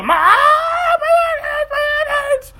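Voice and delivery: very high, drawn-out wailing scream; imitated child screaming. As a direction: scream it like a kid in pain. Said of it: "He's screaming his head off".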